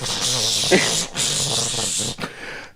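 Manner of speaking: long hissing whoosh imitating a blowtorch; blowtorch noise. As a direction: mimic a roaring blowtorch with a drawn-out hiss. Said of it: "he's got a blowtorch, and he's like".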